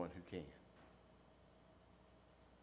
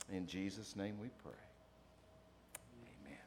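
pastoral prayer; 4 semitones lower than usual. Real, not acted. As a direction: pray gently over the congregation. Leads a prayer.

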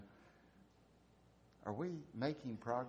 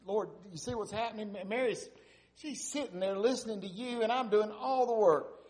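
higher, louder whiny complaint; complaining Martha; 11 semitones higher, 6 dB louder than usual. Acted. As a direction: complain to the Lord like a put-upon sister. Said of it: "Martha comes in verse forty and she says".

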